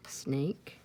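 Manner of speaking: ordinary delivery, nothing marked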